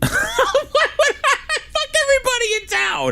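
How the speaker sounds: high, laughing, gleeful; laughing outburst. Real, not acted. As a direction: whoop it out through laughter.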